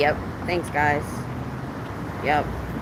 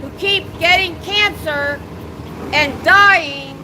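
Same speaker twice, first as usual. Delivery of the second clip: drill sergeant voice. Real, not acted. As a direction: shout it out to the building. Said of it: "I'm using my drill sergeant voice".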